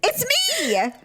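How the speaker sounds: high, drawn-out squeaky admission; comic confession. Real, not acted. Sister admits she is the screamer, comic squeal. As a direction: own up in a silly high voice.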